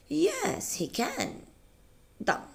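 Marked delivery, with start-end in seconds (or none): 0.1-1.4 "Yes" jumps high, "he can" falls; up then down. Shown as a demonstration. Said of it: "Up, then down".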